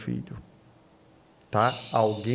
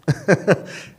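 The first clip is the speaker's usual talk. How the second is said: a quick amused chuckle; short laugh; 6 semitones higher, 6 dB louder than usual. Real, not acted. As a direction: laugh lightly mid-point. Laughs mid explanation.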